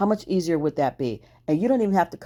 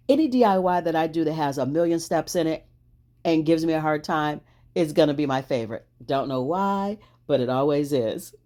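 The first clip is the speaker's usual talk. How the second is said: ordinary delivery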